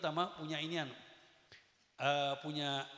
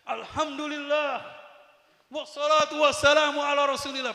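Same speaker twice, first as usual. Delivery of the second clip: mock shouted sermon; 10 semitones higher, 13 dB louder than usual. Student shouting his practice sermon alone, mock shout. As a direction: belt it out like a keen student preacher. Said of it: "Teriak-teriakan".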